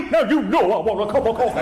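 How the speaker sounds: high, fast, emphatic retort; passionate coworker voice. Retold or imitated. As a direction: hit the no hard and fast, like passion about nothing. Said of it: "she was so passionate about anything she'd say".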